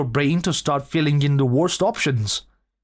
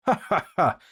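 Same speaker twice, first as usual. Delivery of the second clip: gleeful ha ha ha; triumphant laugh. Real, not acted. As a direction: laugh at your own prank. Laughs after the prank.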